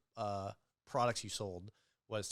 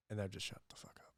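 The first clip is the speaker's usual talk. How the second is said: whispered aside, no voice at all; a hushed, unvoiced confession; 7 dB quieter than usual. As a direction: breathe it like a secret. Whispered aside about the customers.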